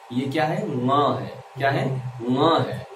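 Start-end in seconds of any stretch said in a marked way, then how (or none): 0.7-0.9 "ङ" as one nasal syllable; single nasal nga for "ङ"; correct nasal ङ. Shown as a demonstration. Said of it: "ये अंगा नहीं है". 2.3-2.6 "ङ" as one nasal syllable; single nasal nga for "ङ"; correct nasal ङ. Shown as a demonstration. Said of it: "ये अंगा नहीं है".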